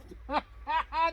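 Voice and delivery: a loud, high scornful laugh; mocking laugh. Rent collectors laughing at the widow's offer, scornful laugh. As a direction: laugh them off with contempt. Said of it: "They just laughed".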